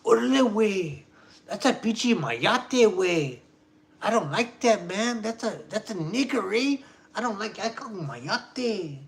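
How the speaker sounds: high, lilting Chicano accent impression; hammed-up Chicano accent, high-pitched; old-school Chicano accent. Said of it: "all those people were talking like that".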